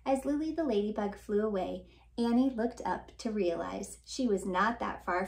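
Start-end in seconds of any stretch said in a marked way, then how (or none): none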